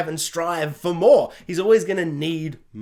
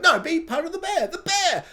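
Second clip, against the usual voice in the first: much higher, begging; mock pleading, high voice; pleading fan; 12 semitones higher than usual. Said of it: "we're like".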